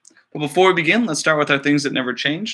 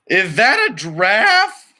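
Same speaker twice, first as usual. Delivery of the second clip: slow, louder, higher puzzled question; surprised director; 6 semitones higher, 5 dB louder, much slower than usual. Acted. The director noticing a giraffe on set, surprised. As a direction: spot the giraffe in disbelief.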